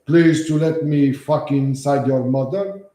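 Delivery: a light mock foreign accent, slow and pleading; broken-English accent to rile the caller; fake foreign accent. Streamer trolls scam caller in a lightly done fake foreign accent. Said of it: "I start talking with an accent".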